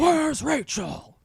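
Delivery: Batman voice. Acted. Batman growl quote.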